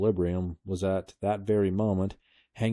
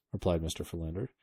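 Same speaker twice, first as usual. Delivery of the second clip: ordinary delivery, nothing marked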